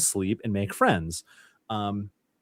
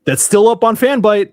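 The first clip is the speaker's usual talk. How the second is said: much higher and louder, gleeful announcement; excited; 10 semitones higher, 10 dB louder than usual. Real, not acted. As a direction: proudly blurt it out to the whole room. Excited boast about old article.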